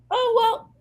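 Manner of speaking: mock the clerk's absurd question; high fast mock clerk. Retelling the clerk's job question, high fast mockery. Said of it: "and she's like".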